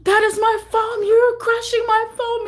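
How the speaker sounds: high, loud, half-sung lament; wailing protest. Retold or imitated. Pleading with Carlos over her crushed phone, high wailing. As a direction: wail it like a sob. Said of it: "I was like".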